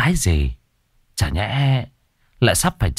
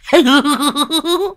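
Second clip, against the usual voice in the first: rising cackle. Acted. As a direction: laugh at your own joke. Old man cackles. (cười hô hố)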